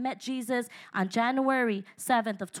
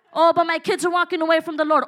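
whiny excuse; 4 semitones higher, 6 dB louder, faster than usual. Retold or imitated. Mocking excuses of believers.

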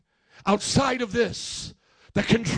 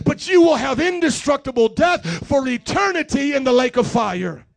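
loud preaching that climbs higher and louder toward the lake of fire; full-force preaching; 3 semitones higher, 6 dB louder than usual. Acted. Preacher warning the congregation about eternity, thundering loud.

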